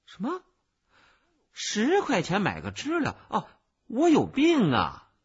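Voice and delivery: high, loud, indignant disbelief; incredulous outrage. A narrator's reading. Child haggles over the price, scandalised. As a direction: balk loudly at the price, pitch jumping up.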